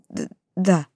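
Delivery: scared, faltering mumble; frightened mumble. A narrator's reading. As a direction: stammer it out in fear. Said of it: "испуганно пробормотал медведь".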